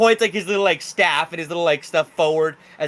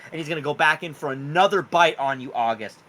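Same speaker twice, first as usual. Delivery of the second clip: ordinary delivery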